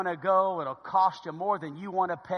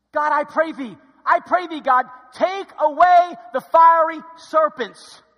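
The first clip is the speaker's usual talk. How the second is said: raised, insistent cry to God; loud pleading prayer; 9 semitones higher, 14 dB louder than usual. Acted. Moses asking God for relief, loud pleading prayer. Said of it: "Moses asked, didn't he?".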